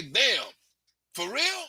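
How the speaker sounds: mock shocked question; incredulous. Shown as a demonstration. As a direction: build to disbelief. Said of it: "if you say".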